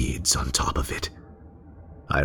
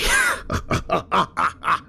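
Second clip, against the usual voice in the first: very high breathless madman's giggle; mad giggle. Acted. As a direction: let a crazed giggle escape. Killer gloats over servant.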